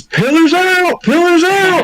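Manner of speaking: very high, drawn-out mock holler; imitated high-pitched warning cry; cross-field call. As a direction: call slowly across a field. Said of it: "all I hear from the cross field is".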